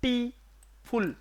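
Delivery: टी, pause, फुल as separate beats; syllable demo. Shown as a demonstration. Teacher counting syllables in beautiful, टी and फुल said apart. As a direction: split the word into टी and फुल. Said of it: "यहाँ पे एक syllable".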